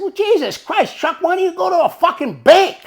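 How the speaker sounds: incredulous scolding of a friend; exasperated outburst. Retold or imitated. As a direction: demand it as if the answer is obvious. Exasperated retort to his friend.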